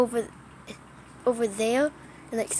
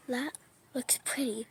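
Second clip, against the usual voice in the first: whispering; 7 dB quieter than usual. Real, not acted. Quiet awe at lightning.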